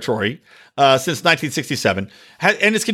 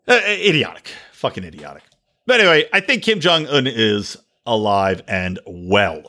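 usual speaking voice

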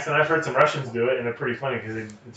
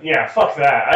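ordinary delivery

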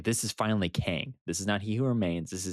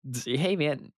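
much higher, eager "what if" voice; mock pitch; 9 semitones higher than usual. Teasing his own what-if ideas, high eager voice. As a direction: pitch it like an overexcited fan. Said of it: "You and your what ifs".

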